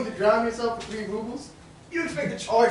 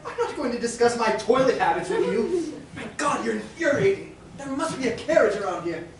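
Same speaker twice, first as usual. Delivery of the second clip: normal talking voice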